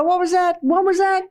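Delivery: the organs yelp in surprise; puzzled organs. Pretend. Organs react to fast swallowing.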